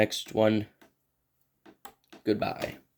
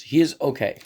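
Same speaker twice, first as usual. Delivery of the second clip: real voice crack, jumping higher and louder; voice breaking; 4 semitones higher, 5 dB louder than usual. Real, not acted. Reviewer giving his verdict on the figure, voice breaking. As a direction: let the voice break upward mid-phrase. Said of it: "My voice just broke again".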